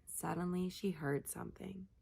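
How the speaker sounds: ordinary delivery, nothing marked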